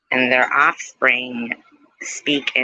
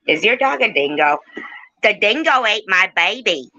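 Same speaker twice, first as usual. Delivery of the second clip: badly faked Australian, pitched up; attempted Australian accent; 7 semitones higher than usual. Host teases Aussie Amy about dingoes, attempted Australian accent. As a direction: put on a hammy Aussie accent. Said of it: "I can't do Australian accent either".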